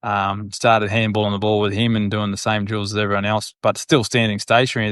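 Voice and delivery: ordinary delivery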